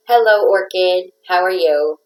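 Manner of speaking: usual speaking voice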